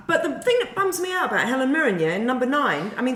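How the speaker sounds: ordinary talk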